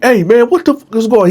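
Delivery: the guard bursting in demanding to know what's happening; barking guard. Retold or imitated. Guard barging in.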